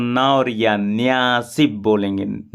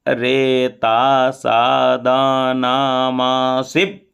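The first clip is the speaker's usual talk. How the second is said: वारुणस्य stretched slowly; drawn out; slower than usual. Shown as a demonstration. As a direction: draw the syllables out slowly. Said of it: "थोड़ा खींच कर बोलेंगे". (थोड़ा खींचकर बोलें)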